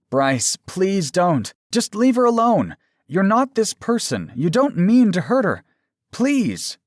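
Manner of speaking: high, loud, anguished plea; desperate pleading. A narrator's reading. Aaron begging his lover to spare her, desperate.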